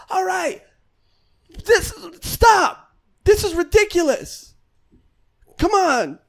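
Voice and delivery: high-pitched imitation of a wrestler girl. Wrestler halting the staged fight before the crowd, high mock protest. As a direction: call a halt in a high, mock-indignant girl's voice. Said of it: "one of the girls goes".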